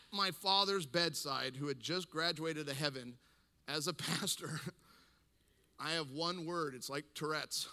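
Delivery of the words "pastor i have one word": normal talking voice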